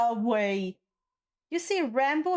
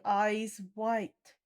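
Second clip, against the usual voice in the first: ordinary talk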